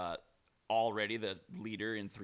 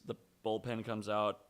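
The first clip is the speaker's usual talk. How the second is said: ordinary talk, nothing marked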